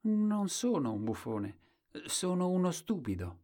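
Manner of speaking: high-pitched storybook fool; Colombin. A narrator's reading. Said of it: "E Colombin diceva".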